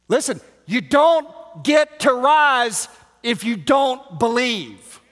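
loud, high, shouted sermon; fiery preacher at full volume; full-force preaching. Real, not acted. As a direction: preach it hard to the back row.

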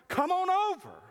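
high, loud come-on-over call; a cheery restaurant host calling; mock beckoning. A waiter in a vision beckoning churchgoers, high and loud.

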